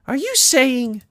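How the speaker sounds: high, breathlessly hopeful pony question; Pinkie Pie. Acted. Pinkie asking if he is staying, amazed.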